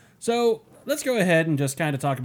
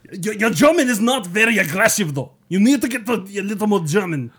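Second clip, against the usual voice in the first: loud, hammed-up German accent; mock German accent; 3 semitones higher, 6 dB louder than usual. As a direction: goad them in loud fake German. Mock German aggression critique.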